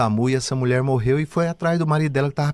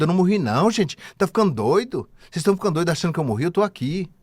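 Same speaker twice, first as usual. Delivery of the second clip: much higher, scolding the crowd; indignant woman voice; 7 semitones higher than usual. Retold or imitated. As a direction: tell them off, incredulous. Revived woman talking to crowd. (mulher indignada, voz aguda)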